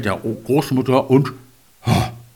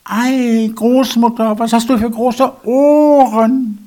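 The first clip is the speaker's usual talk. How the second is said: put-on high, slow little girl; 9 semitones higher, 4 dB louder, slower than usual. A narrator's reading. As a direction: ask wide-eyed like a small girl. Girl questions the wolf.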